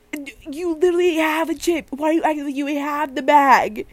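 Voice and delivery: exasperated scolding. Acted. To sister who had half a chip, exasperated scolding. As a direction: tell her off in mock outrage.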